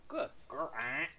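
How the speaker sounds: soft pet praise. Real, not acted. Praising Smudge after let go.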